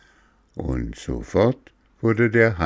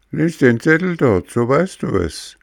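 put-on higher, louder know-it-all boy; cheeky boy; 8 semitones higher, 3 dB louder than usual. Boy tells Bengele to read the sign, cheeky. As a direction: toss it off like a smug kid.

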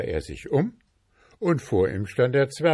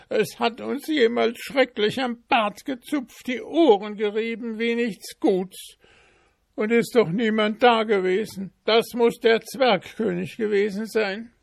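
very high, louder whining; wailing servants; 14 semitones higher, 5 dB louder than usual. Acted. Servant and coachman wailing to goldsmith, very high whine. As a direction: howl about being pinched. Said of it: "heulend hereinstürzten".

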